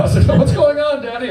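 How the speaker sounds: put-on high, loud child voice; little kid. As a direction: squeak it out like a small child. Kid walks in on parents.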